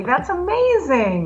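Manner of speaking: high, slowed delight; drawn-out high praise. Real, not acted. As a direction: gush over her news. Congratulating friend on house.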